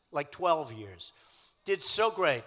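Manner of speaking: ordinary talk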